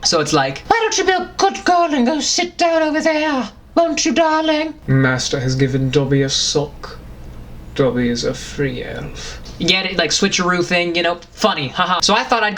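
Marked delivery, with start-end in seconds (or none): 0.7-4.7 squeaky high elf voice, much higher; high squeaky Dobby. Acted. Dobby voicing the gentleman's line, squeaky high. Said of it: "Dobby was then the British gentleman". 4.9-9.4 low, posh British voice; low suave British gent; British gentleman. Acted. Gentleman reading Dobby's sock line, low British. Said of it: "the British gentleman was then Dobby".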